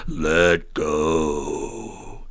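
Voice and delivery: a grand movie God; booming voice of God. Acted. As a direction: heavenly voice answers the dangling man. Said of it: "this booming voice come out in response".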